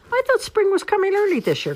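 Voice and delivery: high, loud squirrel grumble; complaining. A narrator's reading. Squirrel protesting the new snow, high complaint. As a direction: complain in a high-pitched squeal. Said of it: "complained Squirrel".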